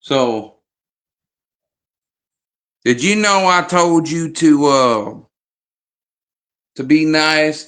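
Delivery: a hint of a stern old matriarch; Madea scolding. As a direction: scold him like a stern grandma, lightly voiced. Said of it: "I might sound like Madea".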